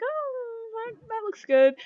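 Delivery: high-pitched musing reaction; intrigued orcs. Acted. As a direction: voice someone being won over. Voices orcs finding offer sensible.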